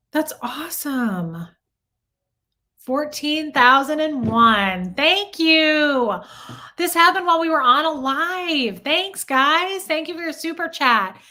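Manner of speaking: real delight bursting out on stream; excited thanks to viewers for fourteen thousand subs. Real, not acted. Hitting fourteen thousand subs live.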